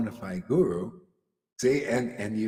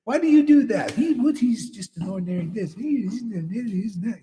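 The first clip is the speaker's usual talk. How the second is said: mock scolding; 8 semitones higher than usual. Critics belittling her guru, higher mock scolding. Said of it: "the ones that".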